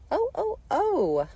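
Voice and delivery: very high, loud, slow wails; whistle-like screams. A narrator's reading. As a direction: scream like a steam engine's whistle. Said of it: "Her screams were so exactly like the whistle".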